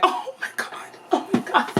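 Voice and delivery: high, breathless laughing squeal of disbelief; giddy squeal. Real, not acted. Girl squealing after the senior says he likes her. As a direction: squeal it out, half whisper, half giggle.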